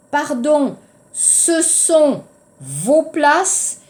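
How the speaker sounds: "ce sont vos places" stretched out slowly, each word separate; drawn out so the class can copy it; slow model sentence. Shown as a demonstration. Teacher modelling the plural sentence slowly for the class. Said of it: "Changez le verbe, pluriel".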